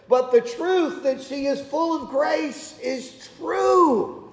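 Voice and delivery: ordinary delivery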